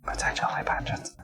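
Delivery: unvoiced hushed aside; hushed whisper segment intro. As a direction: whisper it like a secret.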